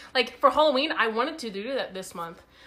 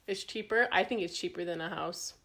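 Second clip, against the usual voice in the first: usual speaking voice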